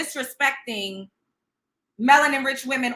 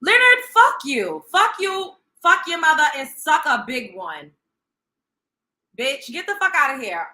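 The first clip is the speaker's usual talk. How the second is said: furious outburst; 5 dB louder than usual. Real, not acted. Cussing out the commenter Leonard, furious yell. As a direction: blast him with rage.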